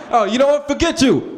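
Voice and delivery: high, loud huffy brush-off; squeaky pretend outrage; mock angry dismissal. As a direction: storm off mid-argument, hammed up. Acted roommate argument ending.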